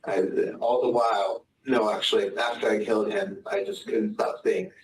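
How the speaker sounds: usual speaking voice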